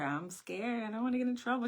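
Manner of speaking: put-on small, high, frightened child's voice; scared little girl. Little girl telling her mother she's scared, high and timid. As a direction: sound like a scared kid confessing.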